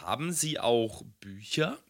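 high, soft, slow shy customer; timid customer voice. Acted. Customer role in sketch.

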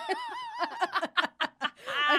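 shrieking laugh, high; high squealing laugh. Real, not acted. Big laugh.

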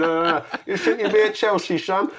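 mock jeering. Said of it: "teasing at football as a kid".

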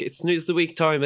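sing-song segment jingle; jingle announcer. Pretend. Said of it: "That's the new jingle".

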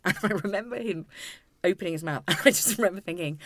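usual speaking voice

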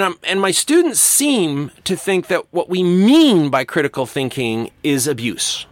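ordinary talk, nothing marked

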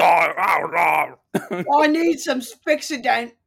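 loud, slow slurring round imaginary loose dentures. Pretend. Joking about needing denture glue, mock loose-dentures slur. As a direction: talk as if false teeth are slipping out. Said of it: "that's how I would sound".

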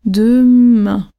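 "demain" drawn out, MAIN gently falling; slow-motion "demain" with accented ending; slight final descent. Shown as a demonstration. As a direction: stretch DEMAIN to show the last vowel descending. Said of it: "le un descend légèrement".

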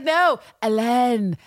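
slow correction, "Hélène" said the French way; French pronunciation. Retold or imitated. Villanelle corrects Paul about her boss's name, slow French "Hélène". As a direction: drag out "Hélène" with a French vowel. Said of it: "And Villanelle's like".

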